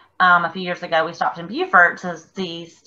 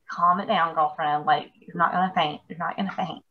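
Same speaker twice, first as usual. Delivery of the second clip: self calming; 3 semitones lower, 8 dB quieter than usual. Retold or imitated.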